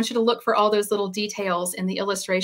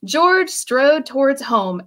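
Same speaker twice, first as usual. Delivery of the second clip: plain reading voice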